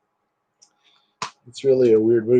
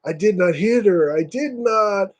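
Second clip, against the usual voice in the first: high, mildly outraged denial; high mock outraged denial; famous denial; 8 semitones higher than usual. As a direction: deny it like a bad actor. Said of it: "he goes".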